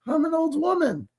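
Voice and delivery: put-on high, wavering old-lady voice; high mock-quavery mom; imitating old mom. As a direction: mimic your elderly mother's disbelief. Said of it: "She's like".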